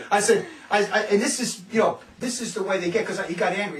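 ordinary talk

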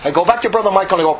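normal talking voice

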